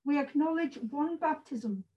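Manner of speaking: solemn formal service reading; formal liturgy. Acted. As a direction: read the liturgy with measured dignity. Reads baptism liturgy.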